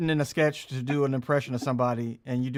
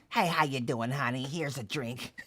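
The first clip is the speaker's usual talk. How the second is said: a hint of a sugary bar lady, softer; sweet bartender; 3 semitones lower, 5 dB quieter, slower than usual. Same bar lady turning softly sweet to a regular. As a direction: switch to sweet for the customer. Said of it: "they would be like".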